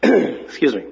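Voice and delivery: husky, scratchy throat after clearing; hoarse after throat clearing. Real, not acted. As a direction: clear the tickle, then speak huskily. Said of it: "clears throat".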